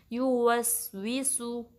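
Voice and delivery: very slow यू वस वी सु, each sound held; slow recitation demo. Shown as a demonstration. Teacher to students, reciting the word slowly. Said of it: "इस word को इस तरीके से पढ़ेंगे".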